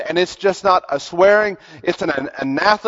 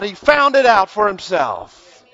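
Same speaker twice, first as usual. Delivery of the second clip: ordinary talk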